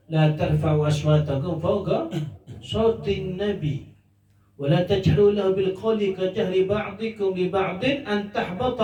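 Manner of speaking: tilawah-style chanting of the ayah; Quran recitation. Acted. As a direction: recite the verse in a measured chant.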